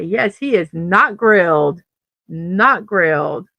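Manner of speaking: NOT in "not grilled" said slow and loud; stress on not. Real, not acted. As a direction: lean hard on "not" in "not grilled". Correcting a chat misreading.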